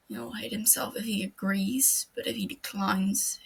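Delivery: ordinary delivery, nothing marked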